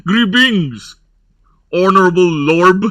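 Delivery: mock Burb accent with a B on every word end; Burb house accent. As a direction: greet like a lord who ends words with B. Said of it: "That's our accent".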